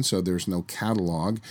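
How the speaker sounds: ordinary talk, nothing marked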